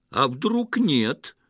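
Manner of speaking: loud, high, drawn-out challenge; agitated shout, stretched out; barked retort. A narrator's reading. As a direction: bark it back in alarm. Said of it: "рявкнул Петро".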